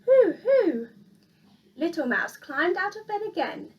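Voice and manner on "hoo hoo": loud, high hooting like an owl; spooky loud owl hoot. A narrator's reading. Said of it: "someone calling".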